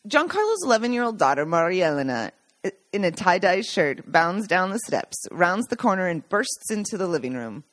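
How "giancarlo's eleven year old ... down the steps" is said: plain reading voice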